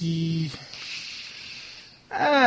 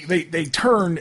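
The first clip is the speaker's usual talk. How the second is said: normal talking voice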